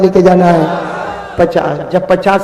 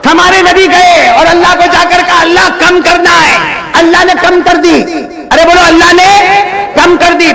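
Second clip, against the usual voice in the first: high, ringing, rhythmic sermon cadence; full-force preaching; 11 semitones higher, 4 dB louder than usual. Acted. Preacher counts down the reduced prayers for the crowd, high rhythmic full-force preaching.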